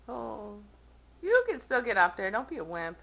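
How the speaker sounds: ordinary delivery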